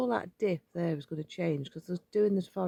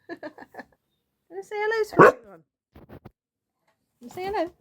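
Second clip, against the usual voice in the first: high, slow sing-song coaxing to the dog; 10 semitones higher, 7 dB louder, slower than usual. Real, not acted. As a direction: coo at your pet to get him to greet everyone. Coaxing the dog to greet viewers.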